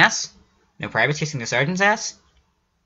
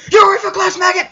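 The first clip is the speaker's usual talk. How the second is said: high, full-volume military bellow; loud, strained sergeant's yell; drill sergeant shout; 5 dB louder than usual. A narrator's reading. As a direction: scream it like a drill sergeant at a recruit. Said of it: "drill sergeant uniform shouts to you".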